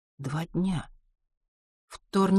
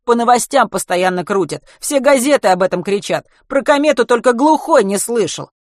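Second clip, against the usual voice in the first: loud, raised-pitch incredulity; incredulous; 6 semitones higher, 9 dB louder than usual. A narrator's reading. Driver to a stranger unaware of the comet, loudly incredulous. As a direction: pile it on loudly, amazed he doesn't know. Водитель поражён неведением собеседника.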